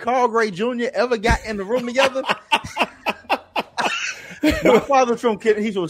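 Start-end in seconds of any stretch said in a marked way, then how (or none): 0.0-2.2 laughing, high voice; laughing while talking, pitched up. Real, not acted. Laughing about his father. 2.3-4.6 high laugh. Real, not acted. Laughs about father.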